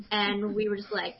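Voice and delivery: ordinary delivery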